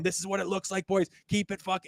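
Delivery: fired-up coach. Retold or imitated. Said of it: "our coach is going".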